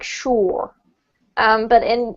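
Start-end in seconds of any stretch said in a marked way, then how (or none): 0.0-0.7 "sure" with an American R; US accent. Shown as a demonstration. Teacher contrasting accents for a student, US accent. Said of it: "in a U S accent, this word's more like".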